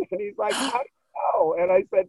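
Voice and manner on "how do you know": high, squeaky disbelief with a rising end; mock incredulous boss. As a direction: play him stunned and disbelieving. Boss amazed, mimicked in retold dialogue.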